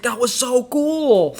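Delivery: put-on slow, high, amazed kid voice; awed kid. Remembering himself as an amazed kid watching, slow and high. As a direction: marvel at it like a wide-eyed child. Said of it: "as a kid, I was like".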